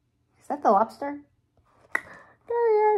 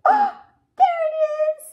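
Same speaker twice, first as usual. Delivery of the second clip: high, loud happy find; excited discovery; 6 dB louder, much faster than usual. Real, not acted. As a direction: point it out with high delight. Found the X.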